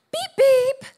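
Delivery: high, loud mock horn beeps; playful beep beep. Wife beeping at a lady blocking the aisle, in a high, loud playful voice. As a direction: honk like a little car.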